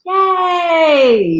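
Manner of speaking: loud high yay; excited cheer. Real, not acted. Wife cheering about watching Gremlins tomorrow, loud joyful yay. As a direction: cheer it out with glee.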